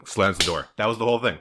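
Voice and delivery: ordinary talk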